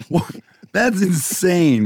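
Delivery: high, slow disbelief; real stunned laugh, pitch jumping up; shocked. Real, not acted. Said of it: "shocked reaction to guess".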